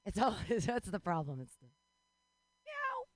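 normal talking voice

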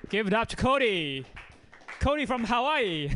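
loud, high host hype; loud emcee hype; hype-up intro. Real, not acted. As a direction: pump up the crowd for the next comic. Host hyping next comic.

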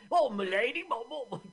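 hammed-up Cockney. Mimicking a Cockney in a horse carriage, hammed-up Cockney. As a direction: parody an old Cockney coachman. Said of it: "I mean, it's so Cockney".

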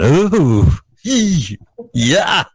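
high wincing "ooh" and "yee"; pained reaction noises. Shown as a demonstration. Showing Carl a comedy reaction shot, hammed-up wincing. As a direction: run through cringing reaction sounds, one by one. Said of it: "here's my reaction shot".

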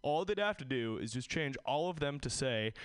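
usual speaking voice

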